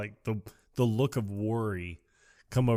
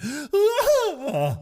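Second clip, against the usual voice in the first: high, loud comic shriek; hammed-up yelp of alarm; startled mock scream; 7 dB louder than usual. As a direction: scream like you've been walked in on. Acting Ace caught by surprise.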